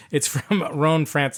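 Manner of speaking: ordinary talk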